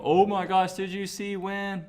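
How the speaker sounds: squealed, high-pitched fan imitation; imitating gushing students. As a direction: gush like an overexcited fan spilling spoilers. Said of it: "Probably be like".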